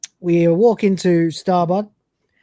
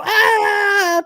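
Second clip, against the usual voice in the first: high loud panic scream; panicked scream; 5 dB louder than usual. Retold or imitated. Cat throws the hawk away, high panic scream.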